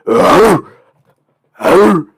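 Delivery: loud, high growly bark; imitated dog bark. Student imitating a big dog jumping up, loud bark. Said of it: "this big dog just goes".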